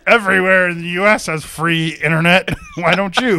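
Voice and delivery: loud, high mock complaint; upset guest, imitated. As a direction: mimic an indignant hotel guest, half laughing. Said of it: "got upset".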